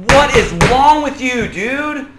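high, loud, drawn-out indignation; outraged condemnation. Acted. Friend condemning an open adulterer, outraged. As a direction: act out a scolding, leaning on each question. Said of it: "you'd be like".

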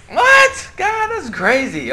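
high, stunned "what?" then a slow protest; mock outrage at a crazy idea; shocked disbelief. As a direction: jump up in pitch at the shock. Retelling his shock.

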